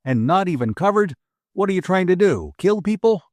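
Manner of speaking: rising, heated rebuke; angry exclaiming. A narrator's reading. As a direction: build the outrage toward the end. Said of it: "the trooper exclaimed".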